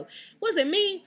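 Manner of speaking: high, loud denial; indignant. Real, not acted. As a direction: protest it wasn't you. Heated denial.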